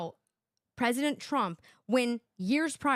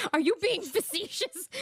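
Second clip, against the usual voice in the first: higher hammed-up Meredith voice; higher, baffled mock TV host; 9 semitones higher than usual. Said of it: "Meredith says".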